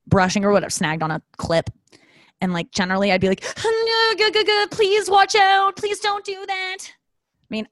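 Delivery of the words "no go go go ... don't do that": very high, slow panicked plea; mock panic. Customer panicking as her hair gets snagged. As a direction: beg the hairdresser to stop.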